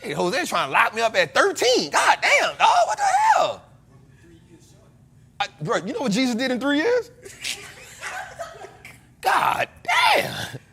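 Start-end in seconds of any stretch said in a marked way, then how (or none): 1.9-3.6 high, loud disbelieving yell; outraged shout. Real, not acted. Outraged at friend's jab. 9.2-10.6 high, shouted God damn; squeal-shout of protest; outraged shout. Real, not acted. Reacts again to the joke.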